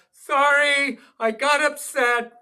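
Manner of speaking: wife apologizing. Retold or imitated. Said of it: "Then she'd quickly apologize".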